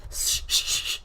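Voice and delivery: hard, loud shh at the cat; loud shushing. Real, not acted. Streamer shushing her cat away from the wires, loud.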